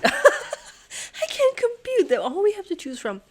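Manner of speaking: high, loud laugh through the words; laughing disbelief. Real, not acted. Puzzler laughing in disbelief at the grid's options. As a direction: laugh it out, can't believe it.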